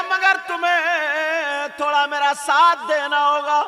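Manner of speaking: high, very loud drawn-out chanting cry; ringing, sustained preacher's chant; full-force preaching. Retold or imitated. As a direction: preach it at full volume, stretching key words.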